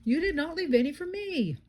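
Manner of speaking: high, whining storybook yell. A narrator's reading. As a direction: wail the complaint, pitched well up. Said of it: "Boris yelled".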